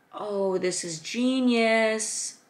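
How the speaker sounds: lingering, amazed admiration; awed delight. Real, not acted. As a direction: marvel at it, stretching every word. Gushing over planner page.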